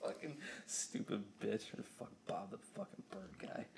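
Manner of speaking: soft, breathy laughing curse; quiet giggling insults; laughing mutter. Real, not acted. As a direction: curse him under your breath while laughing. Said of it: "laughing curse muttered".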